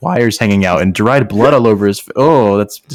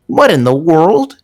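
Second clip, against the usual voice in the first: hissed; 8 semitones higher, 3 dB louder, slower than usual. A narrator's reading. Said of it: "he hissed".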